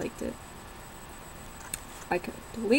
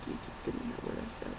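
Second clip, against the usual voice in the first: whispered aside; 14 dB quieter, faster than usual. Retold or imitated. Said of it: "I'm just like".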